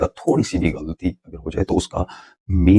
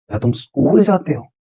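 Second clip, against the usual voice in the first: much higher, stress on जाते with a falling tune; high-pitched take; 8 semitones higher than usual. Shown as a demonstration. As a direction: raise the voice and hit जाते. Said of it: "ऊंची आवाज के साथ पढ़ा".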